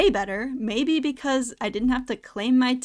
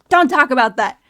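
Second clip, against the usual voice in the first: mock managerial yell, much louder; retold yell; 2 semitones higher, 10 dB louder than usual. Retelling the general manager scolding two coworkers, mock yell. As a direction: imitate a manager telling staff off loudly. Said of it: "that general manager that yelled at us".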